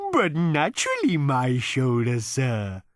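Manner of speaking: high, slow, contented cow voice; contented moo. A narrator's reading. Cow answering Arthur, in a high, slow contented moo. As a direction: moo it out pleased with yourself. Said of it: "mooed the animal contentedly".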